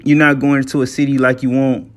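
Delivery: usual speaking voice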